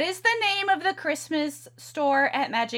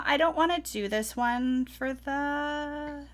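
ordinary talk, nothing marked

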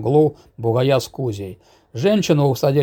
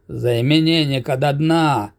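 ordinary delivery, nothing marked

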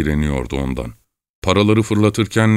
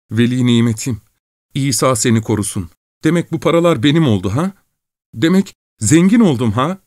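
put-on higher, giddy young peasant; cry of joy; 7 semitones higher, 3 dB louder than usual. A narrator's reading. Young peasant grabs the money, higher giddy cry of joy. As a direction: burst out in greedy joy. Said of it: "bir sevinç çığlığı kopardı".

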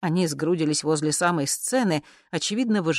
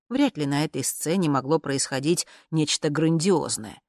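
ordinary delivery

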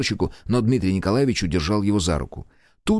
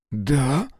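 ordinary delivery

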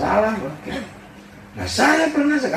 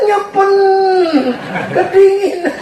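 put-on much higher, squeaky cold-child voice; mock shivering child; 4 dB louder than usual. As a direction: squeak it like a freezing kid after the bath.

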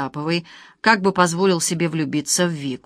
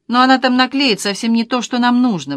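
higher, louder shrill outburst; shrieking; 5 semitones higher, 5 dB louder than usual. A narrator's reading. Vika fearing the ex will ruin it, shrieking. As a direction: squeal it in alarm. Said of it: "взвизгнула Вика".

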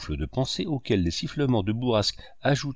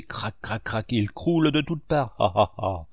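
mocking crack and laugh. A narrator's reading. Fauvarc mimics society collapsing.

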